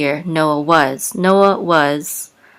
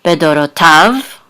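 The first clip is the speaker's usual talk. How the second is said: bedorotav with stress on TAV; last-syllable stress; 4 dB louder than usual. Shown as a demonstration. Teacher showing where the accent falls, stressing bedorotav's last syllable. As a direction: lean on the final syllable of "bedorotav". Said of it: "the accent is on the last syllable".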